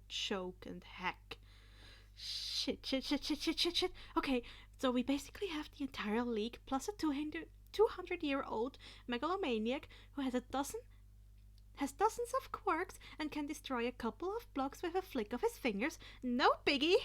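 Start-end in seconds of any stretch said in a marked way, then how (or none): 2.2-3.9 spiral into panic; building panic. Acted. Izuku panicking as the villains arrive. 4.2-17.0 fast, higher frantic whisper; panicked whisper. Acted. Izuku rambling fast about the villain in a panicked whisper.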